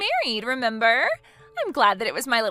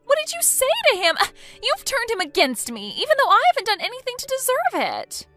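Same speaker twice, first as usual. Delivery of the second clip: high, loud distraught wail; distraught shock; 11 semitones higher, 4 dB louder than usual. Acted. Bride hearing her fiancé left her, distraught wailing. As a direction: fall apart at the news.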